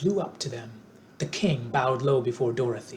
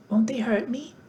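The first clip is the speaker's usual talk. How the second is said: anxious; 9 semitones higher than usual. A narrator's reading. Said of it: "asked the girl anxiously".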